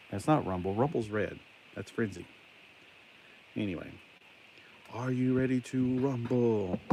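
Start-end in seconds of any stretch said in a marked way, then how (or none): 4.9-6.8 slow, higher, drawn-out announcer call; ring announcer impression. Toy reviewer introducing Rumble like a ring announcer, drawn out. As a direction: stretch it out like a wrestling announcer. Mimics a wrestling announcer's catchphrase.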